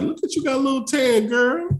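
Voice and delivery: admiring his wife's tan, high and sweet; flattering high voice to his wife. Retold or imitated. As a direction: hype her up in a high sweet voice. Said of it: "I was like".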